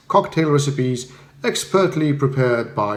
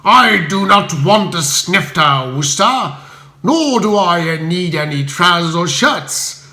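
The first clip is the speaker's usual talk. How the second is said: rude Spode; 2 semitones higher, 4 dB louder than usual. Acted. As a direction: growl each word out like a bully. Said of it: "his unparalleled brand of courtesy".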